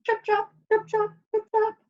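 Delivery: high, light "trip, trap" in a little voice; tiny hoof patter. A narrator's reading. Youngest goat crossing the bridge, high tiny hoof sounds. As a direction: patter like small hooves. Smallest goat's hooves on bridge.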